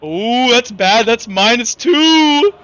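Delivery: usual speaking voice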